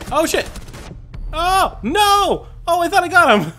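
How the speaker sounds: loud, high-pitched outcry; dismayed shouting. Real, not acted. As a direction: yell in real dismay at a missed kill. Misses enemy in firefight.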